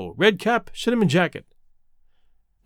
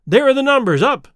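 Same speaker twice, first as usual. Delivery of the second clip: raised, louder call; excited cry; 6 semitones higher, 5 dB louder than usual. A narrator's reading. Spectator spotting the runners' numbers going up, crying out. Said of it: "I cried".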